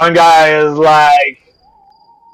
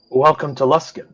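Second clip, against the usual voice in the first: put-on drunk man murmuring close; low drunk mutter; 6 dB quieter than usual. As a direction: lean in and mumble it. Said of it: "he goes".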